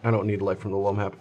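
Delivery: ordinary delivery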